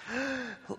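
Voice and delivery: a sharp, high, breathless gasp of shock; mock gasp. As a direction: gasp like a shaken kid watching TV. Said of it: "I start to, like".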